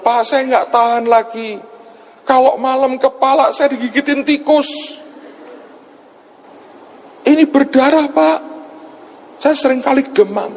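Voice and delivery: high-pitched imitation of a wailing woman; imitated tearful lament. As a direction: lament high, as a crying caller would. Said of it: "Dia nangis, dia bilang". (menirukan ratapan ibu dengan suara tinggi)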